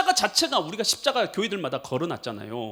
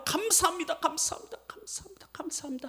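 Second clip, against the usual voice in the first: fading thanks; 11 semitones higher, 5 dB quieter than usual. Retold or imitated.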